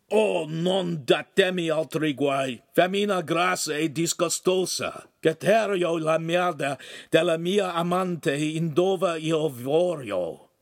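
rasping, scornful Italian retort; rough lout. Acted. Oaf sneering back at the neighbor in Italian, rough. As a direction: brush him off with contempt.

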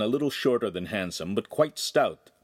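usual speaking voice

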